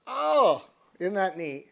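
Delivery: ordinary talk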